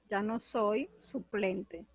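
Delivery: slow, careful reading of "Ya no soy suplente", weight on "soy"; slow clue reading. Shown as a demonstration. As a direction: spell out "Ya no soy suplente" slowly, word by word. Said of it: "Ahora leamos la completa". (lectura lenta y pausada de la pista)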